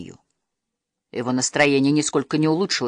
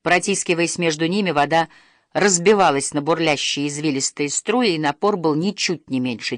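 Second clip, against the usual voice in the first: ordinary delivery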